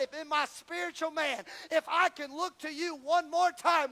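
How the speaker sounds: loud, high, desperate prayer; a blind man's anguished plea at the pillars; Samson pleading to God. Acted. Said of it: "Samson said".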